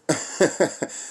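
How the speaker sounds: a short airless laugh of contempt; breathy scornful laugh. Real, not acted. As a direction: laugh off their fallen society. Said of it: "Hey, I'm laughing because".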